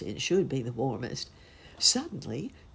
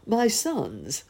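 1 delighted father; 7 semitones higher, 4 dB louder than usual. A narrator's reading. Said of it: "said the cobbler in a great whirl of delight".

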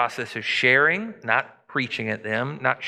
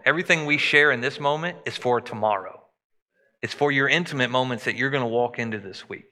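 ordinary delivery

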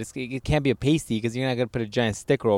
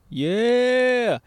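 mock excited yeah; 11 semitones higher than usual. Showing how the pouch looks.

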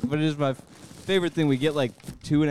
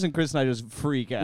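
usual speaking voice

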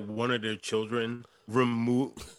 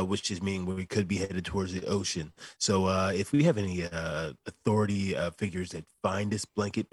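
police radio call; 6 semitones lower than usual. Retold or imitated. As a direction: read it like a deadpan police bulletin. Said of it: "He's like".

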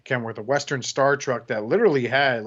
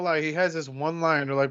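ordinary talk, nothing marked

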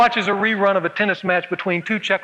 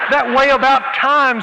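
louder, slow and higher, overcome; emotional Elaine; 6 semitones higher, 6 dB louder, slower than usual. Acted. Elaine answering Roger, overcome with emotion. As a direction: draw it out, deeply moved. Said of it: "says Elaine".

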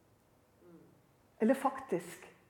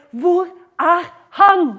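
slow, loud, raised call; crying out; 6 semitones higher, 11 dB louder, much slower than usual. Acted. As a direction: call out each word, drawn out. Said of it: "Det er det de kommer til å rope".

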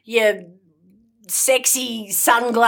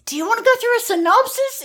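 high, fast mocking repeat; 10 semitones higher, faster than usual. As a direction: parrot the question back in a high sneer.

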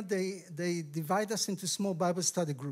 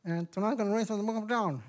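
put-on Southern accent; faster than usual. Lady opening a Bible study, imitated Southern accent. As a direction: imitate a Florida lady's strong Southern accent. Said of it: "she has a strong Southern accent from Florida".

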